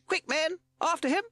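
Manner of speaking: high, loud urgent shout; Captain Beaky shouting. A narrator's reading. Captain as Sid escapes, urgent shout. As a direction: urge the crew into the chase. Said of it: "shouted Captain Beaky".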